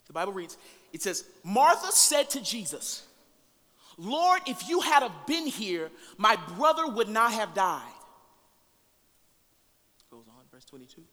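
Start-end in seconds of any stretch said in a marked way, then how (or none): 1.4-7.9 loud, slow scripture preaching; preaching at full force. Acted. Preacher reading Martha's plea to the congregation, full force. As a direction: proclaim each line to the room. Preacher reads the Lazarus passage.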